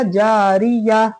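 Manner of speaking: slow, drawn-out जारिया with no -tun; correct pausal ending. Shown as a demonstration. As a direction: stretch जारिया and close it on h. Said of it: "क्या होगा?". (जारिया, गोल ता हा बन के)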